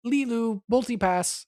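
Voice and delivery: high-pitched playful Leeloo impression; Leeloo imitation. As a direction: chirp it like Leeloo learning words. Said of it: "Mila Jovovich's character".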